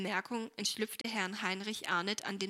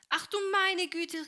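much higher, louder, slow outcry; dismayed cry; 9 semitones higher, 5 dB louder than usual. A narrator's reading. Helene as the dish breaks and water spills: dismayed outcry. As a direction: cry out as the bowl shatters. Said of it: "rief Helene".

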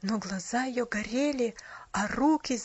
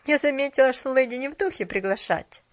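a touch of anger, louder at the start; mildly irritated interruption; slight spite; 3 semitones higher, 6 dB louder than usual. A narrator's reading. Cashier cutting in about the unwilling lady, slightly spiteful. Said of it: "с лёгкой злостью".

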